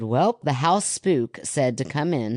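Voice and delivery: usual speaking voice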